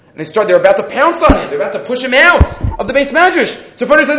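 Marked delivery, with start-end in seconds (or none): none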